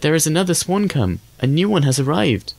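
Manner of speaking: put-on high-pitched, gleeful child's announcement; joyous children's shouting. A narrator's reading. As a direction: cry it out high like delighted children, without real shouting. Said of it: "shouting joyously".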